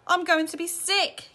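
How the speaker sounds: moan-yell it, queasy. A narrator's reading. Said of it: "yelled Missus T V".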